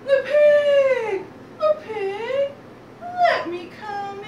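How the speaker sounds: high, loud, drawn-out wolf call. Acted. Wolf demanding entry at the pigs' door, calling out high.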